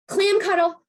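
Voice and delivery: high-pitched loud call-out; playful yell. Shown as a demonstration. Caregiver calling children to hug themselves, yelling. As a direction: yell the silly command at the kids. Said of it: "And then you can yell".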